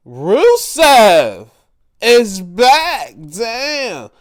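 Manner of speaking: loud, high cry of surprise; thrilled, loud outburst; excited shout. Real, not acted. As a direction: yell it at the TV as he returns. Surprise return on TV.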